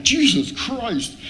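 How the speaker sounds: high, drawn-out stunned oath; retold shock. Man retelling opening the closet, retold shock. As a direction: stretch it out high in disbelief.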